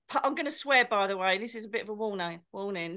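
usual speaking voice

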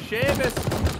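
put-on loud, high slurred Irish drunk; drunk Irishman. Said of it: "the drunk Irishman in there".

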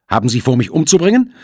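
high, loud alarmed question. Acted. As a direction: ask it in sharp alarm. Character dialogue in audiobook.

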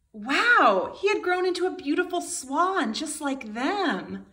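put-on a wide-eyed wow for young listeners; amazed. A narrator's reading. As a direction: marvel at the discovery. Duckling sees reflection, amazed.

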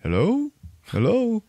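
high, hollow call into a cave. Retold or imitated. Character lost in the cave calling for the boy, high echoing call. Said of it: "he hears Rupert Everett go".

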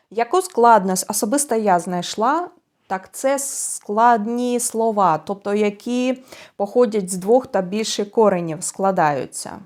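ordinary delivery

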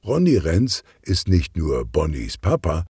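usual speaking voice

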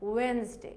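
ওয়েডনেসডে simply as "wens-day"; no D in the middle of ওয়েডনেসডে; correct pronunciation. Shown as a demonstration. Teacher saying what it should just be, wens-day pronunciation. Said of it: "Just কি হবে?".

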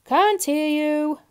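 loud, high, slow shout; drawn-out bellow; booming butcher. A narrator's reading. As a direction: boom it across the counter. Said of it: "boomed the butcher".

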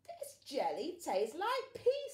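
loud, slow punchline voice; joking broccoli. Acted. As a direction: land the joke gleefully. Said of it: "said Broccoli".

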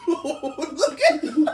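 slow words broken by laughter; laughing. Real, not acted. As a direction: talk while cracking up. Laughing while talking.

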